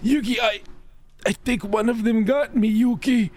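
high, hammed-up distress; mock dying friend. Tristan tells Yugi one of them got him, mock anguish. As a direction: gasp it like a doomed sidekick. Acted bitten friend in zombie parody.

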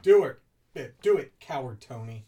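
loud teasing push; loud egging on. Real, not acted. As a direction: egg him on loudly. Egging on co-host loudly.

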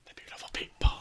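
whispered lyric. Pretend. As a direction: breathe the words in a whisper.

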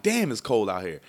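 loud, exclaimed grumble at the cold; complaint about cold. Retold or imitated. Driver steps into cold Philly, loud complaint. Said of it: "It's a breezy as hell".

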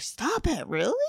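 retold amazed "stop it, really?"; playful mock disbelief at the good news. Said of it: "And we're like".

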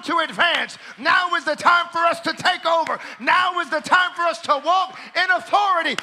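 loud, high declaring preacher; full-force preaching. Acted. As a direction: proclaim it like a revival preacher. Preaching to congregation.

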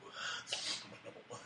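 hushed, drawn-out movie impression; Jack Palance. Fan imitating the villain talking to Bob, Jack Palance impression. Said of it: "doing an impression of Jack Palance".

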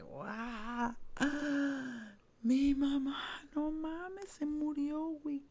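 high, wailing sob on every word; ugly crying. Retold or imitated. Wailing to herself over her dead mother, high and sobbing. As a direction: let it break out in a wail, like a sudden grief. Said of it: "empecé a llorar así, fugly".

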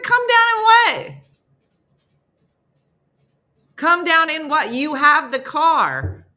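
loud, high disbelief, slowing on the car; incredulous shout. Retold or imitated. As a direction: snaps back at the rep who says come get your stuff, loud disbelief. Incredulous retort to the rep.

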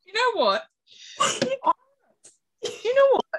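surprised. Real, not acted. Amazed at friend's answer.

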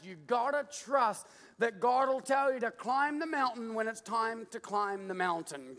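ordinary talk

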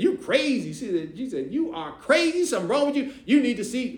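put-on high, loud teen girl scoffing; sassy teen retort. Niece telling him he's crazy, high and loud. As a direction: fling it at him like a teenager.

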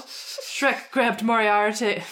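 ordinary talk, nothing marked

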